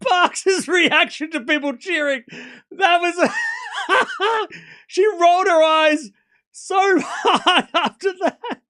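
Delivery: high, loud, slowed by laughter; cracking up. Real, not acted. Commentator cracks up at Parks' reaction to cheering, laughing hard. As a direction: barely get it out through real laughter.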